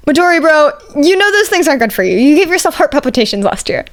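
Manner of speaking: concerned buddy. Acted. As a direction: tease a friend about his energy drinks. Kirishima scolds friend.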